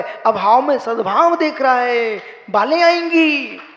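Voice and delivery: high, loud impassioned sermon; full-force preaching. Acted. Monk thundering at devotees about death. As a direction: preach it out with rising fervour.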